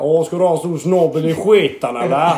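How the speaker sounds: loud, high hammed-up Gothenburg accent; mock Gothenburg dialect. Countering a friend's tease about dialects, mock Gothenburg accent. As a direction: put on a big Gothenburg sing-out. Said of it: "kan säga på göteborgska".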